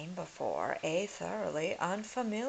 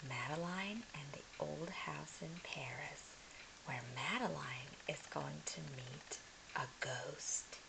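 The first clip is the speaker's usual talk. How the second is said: soft storytime whisper; 9 dB quieter than usual. Acted. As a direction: whisper it like a bedtime secret. Whispered intro to a read-aloud.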